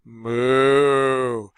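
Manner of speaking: put-on low, drawn-out moo for a child; parent mooing. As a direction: moo like you're teaching a toddler. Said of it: "What does a cow say?".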